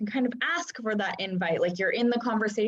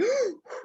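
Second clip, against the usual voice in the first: sharp gasp; 13 semitones higher than usual. Acted. Acting out fight or flight rush.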